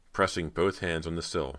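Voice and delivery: usual speaking voice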